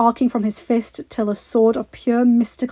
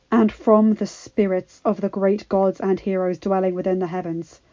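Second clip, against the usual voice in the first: ordinary delivery, nothing marked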